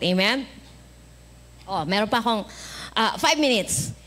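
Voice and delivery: ordinary delivery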